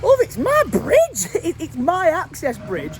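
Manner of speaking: you can't believe the cheek; indignant disbelief. Real, not acted. Landowner protesting to the absent woman, high and indignant.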